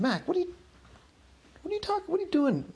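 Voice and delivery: high, fast, stunned pitch shooting up; can't believe a friend just betrayed him; shocked disbelief. Acted. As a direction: voice jumps high in hurt shock. Clinton shocked by friend's accusation.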